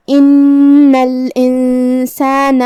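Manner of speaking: ان with the ن held for two beats, slow and chanted; doubled noon. Shown as a demonstration. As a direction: sing ان الانسان slowly, lengthening the شدّہ on ن. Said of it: "تشدید کی اصلیت دو حرف ہوتی ہے۔". (ان میں نون کو دو حرف کے برابر کھینچ کر)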